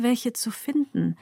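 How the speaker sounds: ordinary delivery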